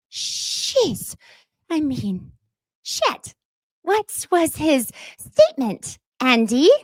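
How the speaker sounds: mock shock. Shocked reaction to PK's statement.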